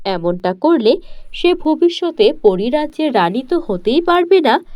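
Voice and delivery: ordinary delivery, nothing marked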